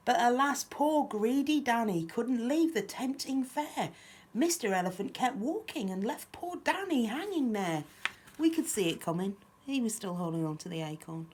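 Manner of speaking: lively, lilting picture-book reading; children's story narration. A narrator's reading. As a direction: read a rhyme aloud to little ones. Reads picture book aloud.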